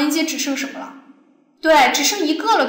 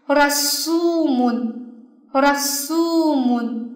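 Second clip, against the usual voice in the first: 라苏mun slowly, "苏" drawn long and weighted; stress on SU; much slower than usual. Shown as a demonstration. Teacher models the word twice, stress on "苏" in 라苏mun. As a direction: hold and stress the middle "苏" of 라苏mun. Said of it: "也在수上面".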